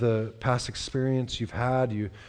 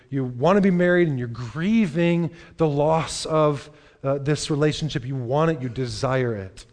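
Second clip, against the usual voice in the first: higher and louder, pressing each phrase; impassioned preaching; 3 semitones higher, 5 dB louder than usual. Acted. Pastor urging the single people in church, impassioned preaching. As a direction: plead with the single listeners at full voice.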